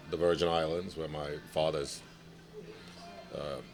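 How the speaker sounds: normal talking voice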